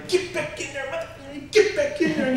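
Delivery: high, strained mock yell; retold angry order. Homeowner orders burglar back through the broken door, mock yell. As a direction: act out yelling at an intruder, high but held back.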